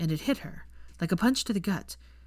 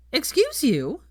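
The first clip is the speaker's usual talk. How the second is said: higher, louder indignant snap; snapped; 8 semitones higher, 5 dB louder, slower than usual. A narrator's reading. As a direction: bite back, offended. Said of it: "snapped Pansy".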